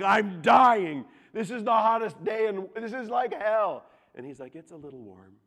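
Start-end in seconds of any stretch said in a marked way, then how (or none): 0.0-3.8 loud, high, hammed-up whining about the heat; dramatic complaint. Son roofing with his dad in scorching heat, dramatic. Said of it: "I'm a little more dramatic". 4.5-5.4 very soft, unbothered understatement; calm dad. Retold or imitated. Calm father answering his overheated son on the roof, unruffled. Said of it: "he's the calmest guy ever".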